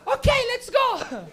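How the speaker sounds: very high, loud, drawn-out cartoon cheer; slow, squealing kids' show host; Dora the Explorer. Cartoon girl rallies the kids watching, loud high voice. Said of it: "walk up to the screen and be like".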